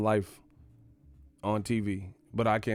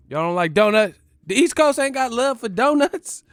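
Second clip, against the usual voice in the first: much louder, higher indignant shout; loud indignant outburst; outraged shout; 11 semitones higher, 7 dB louder than usual. Real, not acted. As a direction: call out the whole coast. Outraged at donut ban.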